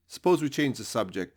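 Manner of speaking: usual speaking voice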